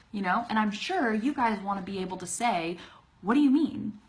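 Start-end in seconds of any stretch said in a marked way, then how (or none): 3.2-4.0 "What do you mean" blended into "whaddaya mean"; higher, louder, words run together; natural linked speech. Shown as a demonstration. Teacher modeling her own way for learners, linked. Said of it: "the way I say it".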